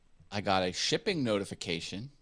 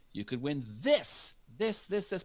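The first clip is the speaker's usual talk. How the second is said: game show host; 6 semitones higher than usual. Retold or imitated. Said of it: "if he said".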